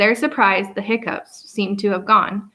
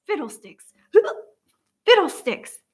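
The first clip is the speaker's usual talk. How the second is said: grumbling; 7 semitones higher than usual. A narrator's reading. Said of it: "the professor grumbled".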